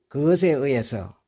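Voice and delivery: normal talking voice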